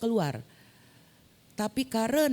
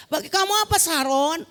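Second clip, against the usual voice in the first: loud singled-out question in a raised pitch; teacher's pointed question; 9 semitones higher, 5 dB louder than usual. Retold or imitated. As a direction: put her on the spot, stretching the words. Teacher repeats question to her.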